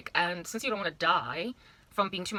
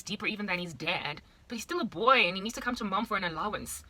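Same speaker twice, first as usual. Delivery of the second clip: normal talking voice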